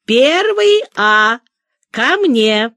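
a confident teacher summoning her class warmly; loud and kind. A narrator's reading. Teacher calls her first-graders to her, loud and kind. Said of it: "громко и ласково говорит".

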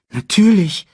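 put-on high, loud child's agreement; eager cub.

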